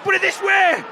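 loud, high yelled instructions; real frustrated fan yelling; exasperated shout. Real, not acted. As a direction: bellow at the player on the pitch.

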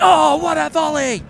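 high, loud, slow mock commentary; excited commentator shout. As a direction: ham up a match commentator. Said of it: "if I just stood up now and went".

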